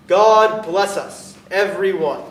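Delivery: put-on loud, very slow child's blessing; Tiny Tim blessing. Tiny Tim blessing everyone, slow and loud. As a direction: bless everyone like a small boy. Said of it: "as Tiny Tim observed".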